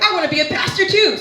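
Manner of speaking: high, quick me-too voice; mock eager uncle. Comedian imitating the uncle who wants to preach, eager mock voice.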